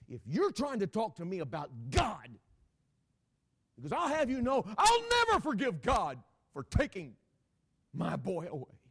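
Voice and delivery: loud, high, bitter outburst; gritted-teeth anger. Retold or imitated. Bereaved father refusing to forgive God, shouting angrily. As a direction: erupt in fury, acting out the grieving father. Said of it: "he gritted his teeth at me".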